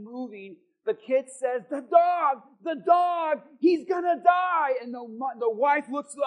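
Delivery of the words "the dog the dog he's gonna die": put-on louder, higher, panicky cry of a kid; panicked child. Kid sees the dog foaming, panicked and loud. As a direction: blurt it like a scared kid.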